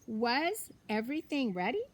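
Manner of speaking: plain reading voice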